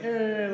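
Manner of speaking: high, loud comic noise; imitated "eh". Man pulling out a gin bottle, comic loud "eh". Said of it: "like this, you know?".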